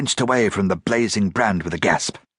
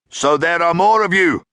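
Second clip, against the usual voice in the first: a clear commanding voice, higher and louder; a leader arriving and addressing the captive with authority; clear, authoritative; 10 semitones higher, 6 dB louder than usual. A narrator's reading. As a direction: announce it with cold authority. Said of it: "said a clear, authoritative voice".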